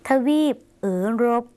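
เอ๋อรบ with a tongue-rolled r; Lao rolled r. Shown as a demonstration. Teacher modelling the Lao word for Europe, rolled r. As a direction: roll the ร in เอ๋อรบ, opening on a long "oe". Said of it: "ใช้รอราชสีห์หันลิ้นนะคะ".

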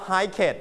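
hiket in two syllables, "hi-ket"; wrong extra syllable. Shown as a demonstration. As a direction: sound the ending of hiket as a full "ket". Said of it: "we don't pronounce hiket". (hiket อ่านผิดเป็น "ไฮ-เค็ท")

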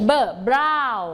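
เบิร์ drawn out very slowly before Brown; phonics sounds. Shown as a demonstration. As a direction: sound out the first letter slowly. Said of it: "ออกเสียง phonics เลยค่ะ". (ออกเสียงเบิร์ช้าๆ ก่อนคำว่า Brown)

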